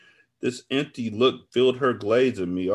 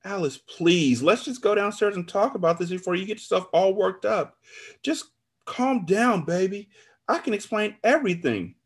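pleading; 11 semitones higher than usual. A narrator's reading. Narrator begs flustered friend to calm down, pleading. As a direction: coax her urgently at a high pitch.

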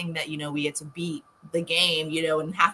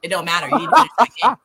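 imitated nagging; 2 semitones higher, 6 dB louder, much faster than usual. Retells fiance nagging about platinum.

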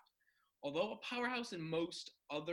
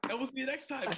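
frat bro; 9 semitones higher, 7 dB louder than usual. Real, not acted. Hyped podcast sign-off.

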